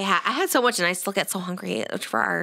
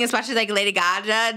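ordinary talk

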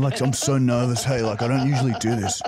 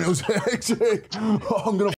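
ordinary talk

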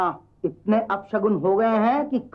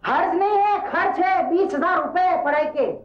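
higher-pitched, emphatic objection; indignant retort; 6 semitones higher than usual. Acted. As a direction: protest the expense in a raised pitch. Objects sharply to the expense. (ऊँचे सुर में नाराज़गी भरा जवाब)

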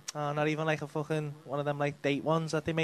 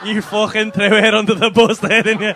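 loud, higher, amused outrage; mock outrage; 5 semitones higher, 11 dB louder than usual. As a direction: call out the betrayal loudly, half laughing. Mock outrage at audience member's betrayal.

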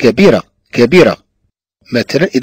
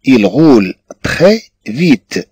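slow dictation; much slower than usual. Shown as a demonstration. Teacher dictating example sentence.

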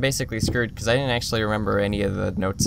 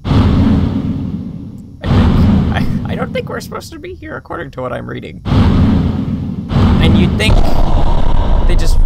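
alarmed; 4 semitones higher, 10 dB louder, slower than usual. Real, not acted. Alarmed at the room.

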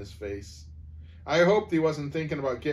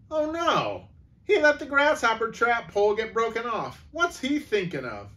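high, alarmed and louder; shocked at the broken pole; narrator's boy voicing sudden alarm; alarmed cry; 11 semitones higher, 5 dB louder than usual. A narrator's reading.

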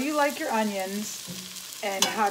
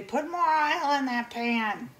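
a light imitation of mom's bossy order, slower and higher; imitating mom; 4 semitones higher than usual. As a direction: mildly mimic mom telling her what to do. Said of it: "my mom would say".

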